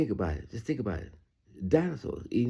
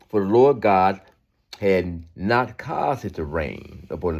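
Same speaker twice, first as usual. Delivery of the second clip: slow, deliberate Bible reading; scripture reading; slower than usual. Acted. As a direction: read it out word by word. Reads verses slowly.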